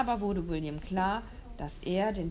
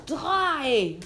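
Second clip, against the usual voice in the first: loud, higher yelled count; called out; 5 semitones higher, 8 dB louder than usual. Acted. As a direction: shout the final number. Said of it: "rief der Jäger".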